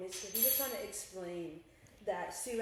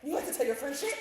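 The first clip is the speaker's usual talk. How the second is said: a hint of an angry mom, pitched up and louder; mom scolding; 5 semitones higher, 7 dB louder than usual. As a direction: lightly imitate a scolding mother. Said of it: "she likes to be like".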